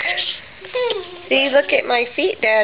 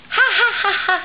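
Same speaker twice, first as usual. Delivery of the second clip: demonstrated laugh; 9 semitones higher, much faster than usual. Shown as a demonstration. Mother teaches her baby to laugh, high demo laugh. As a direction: show the baby how to laugh.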